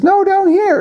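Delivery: very high falsetto little frog; frog princess voice. Acted. Frog redirects the searching boy, high falsetto. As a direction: call up in a squeaky frog voice. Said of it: "and he says".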